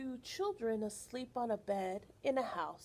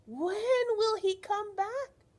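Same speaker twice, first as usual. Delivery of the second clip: put-on eager child's question; 9 semitones higher, 5 dB louder, slower than usual. Ana asking again, more eager.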